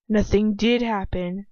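ordinary delivery

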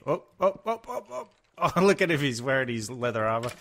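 high, loud laughing squeals; cracking up at the mob's armor; laughing excitedly. Real, not acted. Laughing at a mob in leather armor, high and loud.

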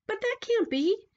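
put-on high, indignant child; protesting child. A narrator's reading. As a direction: object to the ending.

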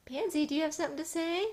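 loud, high, quick question; calling to pet. Real, not acted. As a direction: call it out brightly to the cat. Calls on the cat to speak.

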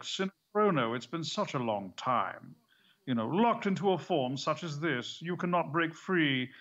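slow British villain voice, Snape-like; drawn-out, menacing British sneer; slow theatrical villain menace. Retold or imitated. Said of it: "this Alan Rickman kind of Snape villain voice".

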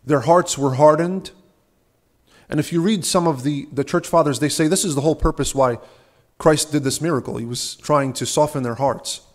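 normal talking voice

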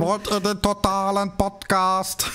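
mock propaganda speech. Parodies old propaganda speech line.

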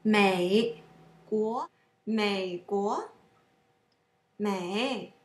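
slow 美国, dip then sweep up; tone drill. Shown as a demonstration. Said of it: "pronounce the third tone longer".

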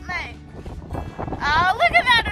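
usual speaking voice

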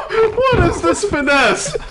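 high laughing disbelief; cracking up in disbelief; laughing shout. Real, not acted. Laughing at a wild play.

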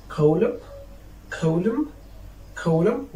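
"column" ending on m, rising; teacher's careful "column", final N left silent. Shown as a demonstration. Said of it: "you cannot pronounce it after M".

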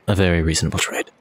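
low, composed formal negotiator; in-character voice. Acted. Character hands stick to wizard, low composed voice. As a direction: close the deal low and smooth. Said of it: "place the stick in his hand and say".

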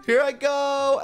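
high-pitched launching cry; excited call. Real, not acted. Player launched from slide, high excited call. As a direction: call out high as you take off.

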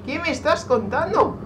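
high, loud incredulous yell; alarmed disbelief. Real, not acted. Player reacting to a shock in game, shrieked disbelief. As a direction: cry out in disbelief, pitch shooting up.

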